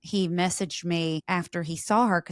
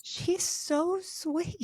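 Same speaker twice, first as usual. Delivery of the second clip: gushing; 12 semitones higher, 4 dB quieter, much slower than usual. Real, not acted. Gushing over a friend.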